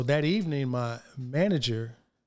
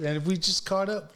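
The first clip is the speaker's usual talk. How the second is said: ordinary delivery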